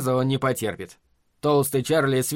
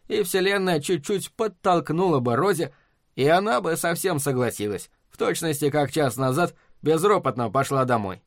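ordinary delivery, nothing marked

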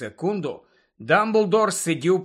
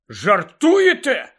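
louder, higher, drawn-out exclamation; exclaiming; 5 semitones higher, 6 dB louder, slower than usual. A narrator's reading. Harry to the portrait about the changed password, exclaiming. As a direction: exclaim it in disbelief, slowly. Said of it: "вигукнув Гаррі".

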